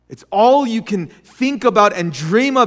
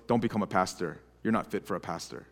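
low, hushed, deflating verdict; quiet, low put-down, retold; quoted pastor's low dismissal; 11 semitones lower, 14 dB quieter, faster than usual. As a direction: quote him quietly, voice dropped right down. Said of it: "he told me, he goes".

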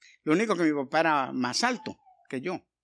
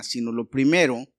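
usual speaking voice

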